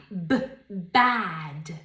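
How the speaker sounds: Bad with a popped B; Bad con B explosiva; explosive B. Shown as a demonstration. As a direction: burst the B in Bad. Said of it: "Con una B explosiva".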